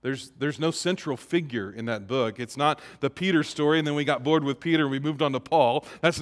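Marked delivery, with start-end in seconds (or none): none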